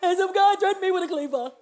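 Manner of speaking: loud, blubbering retelling; mock crying. Crying daughter telling mother she was threatened, hammed-up bawling. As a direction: wail it out like a tearful tattletale. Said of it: "and I'm crying".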